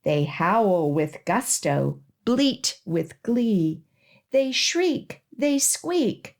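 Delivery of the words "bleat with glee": ordinary delivery, nothing marked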